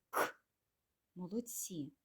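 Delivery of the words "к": К as one short voiceless burst; isolated K sound. Shown as a demonstration. Teacher getting children to repeat, isolated K. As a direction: pop К with no vowel after it. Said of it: "Промовляйте".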